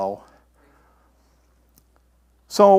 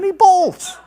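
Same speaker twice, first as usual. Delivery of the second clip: high, louder burst with a laugh; 12 semitones higher, 8 dB louder than usual. Real, not acted. Preacher chuckling at Elijah running off, laughing through it. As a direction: laugh it out in amusement.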